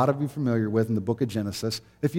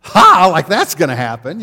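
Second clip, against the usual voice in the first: loud, much higher mocking "Ha? Ha?"; scornful laugh; 6 semitones higher, 12 dB louder, slower than usual. Sarah scoffing at God's promise, loud mocking laugh. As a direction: scoff out loud like it's absurd. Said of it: "She goes".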